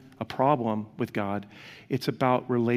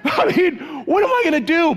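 laughing shout; 14 semitones higher, 6 dB louder than usual. Real, not acted. Laughing at own story.

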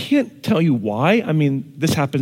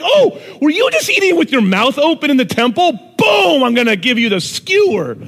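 mock fussy Jesus; 11 semitones higher, 5 dB louder than usual. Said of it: "He's not like".

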